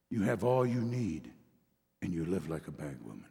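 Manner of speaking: hushed, tender divine voice; gentle voice of God. Acted. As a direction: speak it low and gently as God to a son. Voicing God speaking gently.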